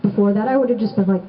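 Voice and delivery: usual speaking voice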